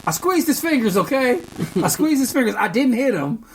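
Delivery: mock indignant child; exasperated kid. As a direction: kid owning up, annoyed. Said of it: "He said".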